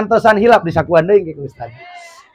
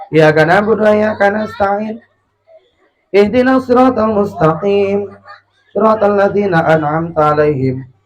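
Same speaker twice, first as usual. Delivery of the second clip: put-on old-man imam; 4 semitones lower than usual. Shown as a demonstration. Mocking how grandpas recite in prayer, low and unmusical. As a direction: intone it feebly, sinking at the line ends. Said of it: "coba lamun aki-aki".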